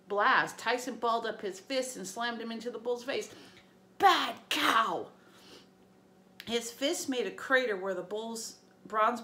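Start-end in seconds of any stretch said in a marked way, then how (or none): 4.0-5.1 put-on loud, simple scolding like a big kid; big childlike giant telling off a bull; childlike scolding shout. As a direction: scold the monster. Tyson punching the bull.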